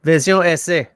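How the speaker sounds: mock French reading of Vision S A; French accent. S0 imitating Google Translate's French accent. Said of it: "You gotta get the accent down".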